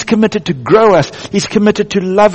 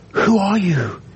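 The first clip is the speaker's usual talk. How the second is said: ordinary talk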